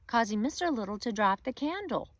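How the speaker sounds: ordinary delivery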